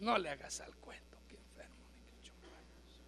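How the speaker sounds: soft unvoiced whisper of God's reply. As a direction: whisper God's rebuke, hushed. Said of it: "Me dice".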